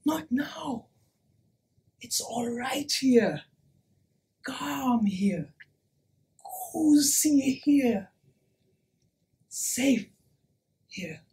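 soft, falling, spaced-out phrases; calm them with quiet pauses; soothing, fading almost to nothing; hushed reassurance. Acted. Reassuring the gathered listeners.

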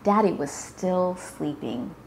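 ordinary delivery, nothing marked

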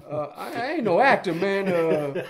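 high, loud mock protest with stammers; comically hesitant retold objection; reluctant self-doubt. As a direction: play up the hesitant newcomer, breaking into laughter. Retelling his doubt on first getting script.

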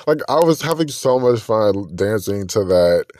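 ordinary talk, nothing marked

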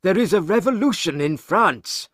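story character breaking momentous news; urgent news-bearer. Acted. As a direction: deliver dramatic news with rising urgency. Banker reports the revolution in Paris.

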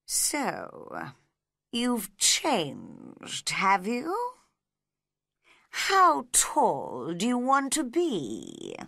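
slow, otherworldly drawl; a sleepy creature weighing her up; lightly voiced drowsy caterpillar; lazy caterpillar. A narrator's reading. Caterpillar questions Alice.

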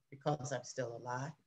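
soft, weak and drained; barely voiced, worn out. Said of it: "He said that in a weak voice".

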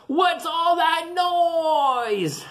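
high, slow, drawn-out shout of a woken bear; grumpy bear shout. Acted. Grumpy bear yelling at the noise, drawn-out high shout. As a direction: bellow it out, sleepy and cross. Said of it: "said Grizzly".

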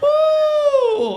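very high, loud sustained "woo!"; shrill-pitched shout of a sudden high; euphoric whoop. Acted. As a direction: let out a long falsetto party whoop. Drug suddenly kicks in.

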